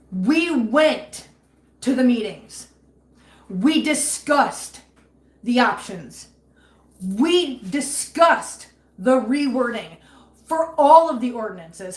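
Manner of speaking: slow, punched phrases, each word stressed; emphatic recounting. Real, not acted. Frustrated owner recounting the meetings with slow heavy stress. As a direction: drive every point home slowly.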